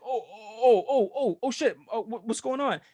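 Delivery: high, stammering mock fright; imitated panic. Retelling the kid facing a gun, high panicked stammer.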